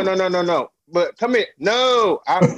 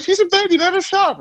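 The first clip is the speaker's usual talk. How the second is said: high mock lady voice, much higher; imitating the store lady. Dad imitating the store lady telling him to let his baby shop, in a high mock voice. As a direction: play the pushy saleslady up high.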